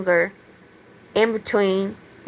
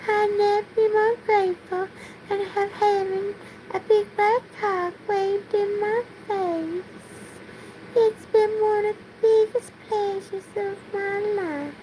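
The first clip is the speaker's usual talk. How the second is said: much higher put-on Southern belle, held throughout; 8 semitones higher, 4 dB quieter than usual. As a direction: keep the high-pitched belle voice going through the rant. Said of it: "I still have to speak like a Southern belle".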